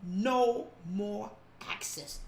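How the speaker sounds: hushed slow declaration; quiet, slow. Real, not acted. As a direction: drop down and say it slow.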